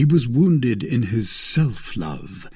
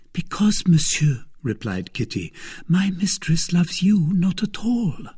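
put-on high, eager young servant girl; timid maid reassuring a visitor; 8 semitones higher than usual. Kitty voiced high.

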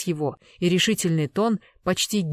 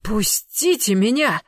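loud, drawn-out choked cry; hoarse plea; 4 semitones higher, 5 dB louder, slower than usual. A narrator's reading. Nephew held by the throat, hoarse plea. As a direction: strain against the grip, slow and higher. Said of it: "хрипел Иннокентий".

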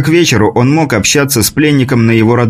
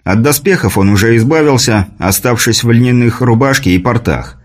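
usual speaking voice

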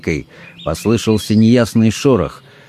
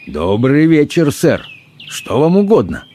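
higher-pitched politeness with cheek; peaceable but cheeky; 5 semitones higher than usual. A narrator's reading. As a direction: civil yet a touch impudent. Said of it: "сказал миролюбиво, но дерзко".

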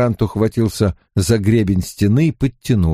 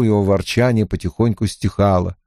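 usual speaking voice

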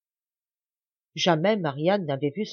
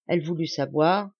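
ordinary delivery, nothing marked

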